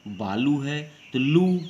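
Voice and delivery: बालू with लू loud, high and long; slow demo, heavy weight on लू; stress on लू. Shown as a demonstration. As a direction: punch and stretch the लू of बालू. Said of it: "बल देकर बोलना पड़ता है".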